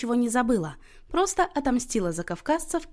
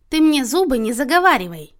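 loud, higher, insistent rebuke; cutting off evasion; 5 semitones higher, 8 dB louder than usual. A narrator's reading. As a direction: refuse to let the subject change. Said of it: "не дала увести разговор в сторону Люся". (громко, не давая увильнуть)

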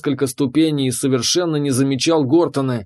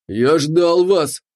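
slow, smug monster voice; smug dragon warrior; 3 semitones higher, much slower than usual. A narrator's reading. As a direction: savor each word, gloating. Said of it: "довольно усмехнулся гигантский драконид".

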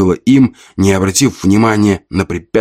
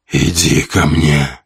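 commanding creature; 2 semitones lower, slower than usual. A narrator's reading. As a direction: order it from the grave. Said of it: "приказало существо".

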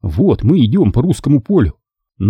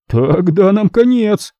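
hoarse; 9 semitones higher, slower than usual. A narrator's reading. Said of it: "заметил Гальс охрипшим голосом".

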